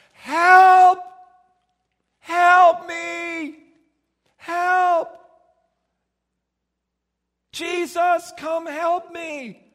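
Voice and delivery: high, loud desperate shouting, slowing at the end. Acted. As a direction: blind beggar screaming to Jesus for help. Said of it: "And Bartimaeus started going".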